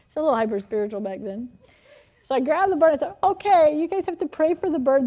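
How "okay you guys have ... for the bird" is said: high, loud bossy urgency; frantic mock command. Aunt telling the children to pray, mock frantic. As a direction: order the kids, hammed-up and flustered. Acting out herself ordering the children.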